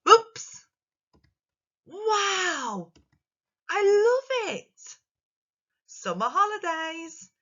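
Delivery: high, bright exclamations full of surprise; excited and surprised. Shown as a demonstration. Teacher showing pupils how exclamations sound, excited. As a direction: gush each one like a delighted child. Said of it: "change our voice to sound more excited and surprised".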